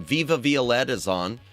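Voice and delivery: ordinary talk, nothing marked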